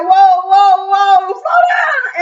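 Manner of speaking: high, loud whoa, whoa, slow down, stretched out; alarmed shout. Retold or imitated. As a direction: shout to stop them before it escalates. Gary breaks up a fight.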